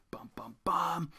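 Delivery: slow whispered dun dun dun; mock ominous whisper before the reveal; dramatic drumroll. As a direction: whisper it like suspense music. Dramatic lead-in to a reading.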